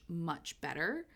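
normal talking voice

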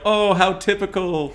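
high, drawn-out mock delight; mock reaction. Viewer scoffs at the film's Canadian casting, sarcastic. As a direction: stretch it out high with heavy irony.

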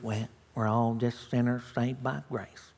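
quoting a cliche in a soft, low voice; mock the pious phrase quietly. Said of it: "to conclude".

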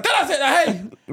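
high, drawn-out mock shout with laughter; imitating the girl. Girl shooing the dog away, laughing mock shout. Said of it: "the girl's like".

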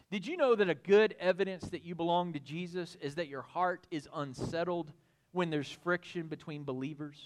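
ordinary talk, nothing marked

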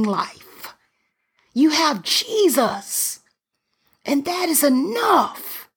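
high, driving sermon voice; building pulpit fervor; fervent preaching. Acted. Preaching at full force.

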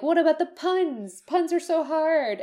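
high, fretful mock complaint; whiny mock quote. Parodying the translators fretting over puns, high and whiny. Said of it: "they were like".

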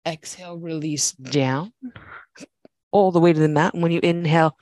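calm, steady class cues; yoga instructor voice. Acted. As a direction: guide the flow evenly.

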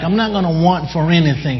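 usual speaking voice

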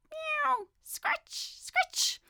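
very high meow, scratchy scratch; frantic cat at the window. A narrator's reading. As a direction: scuffle at the sill like a cat. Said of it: "scuffled Simpkin".

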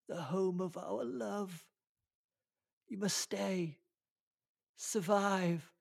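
high, hammy dying woman voice; overacted death scene. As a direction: ham up the dying breath. Hammed dying lover's last words.

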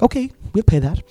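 high, put-on eager client. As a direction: squeak it like a keen customer. Client agreeing to his price.

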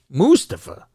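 Mustafa as MOOS-tuh-fuh; first-syllable stress. Shown as a demonstration. Echoing the announcers' version, Mustafa stressed up front. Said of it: "that's close to Christopher".

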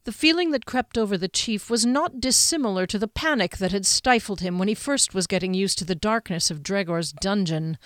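ordinary delivery, nothing marked

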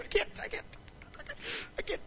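whispered, hammed-up sobbing can't; mock sobbing whisper. Pastor pretending to break down at the trash, whispered sobbing. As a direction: pretend you're too choked up to let go. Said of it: "I don't cry".

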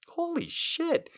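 high, slow, stunned; shocked. Real, not acted. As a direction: react in high disbelief. Reader's shocked reaction.

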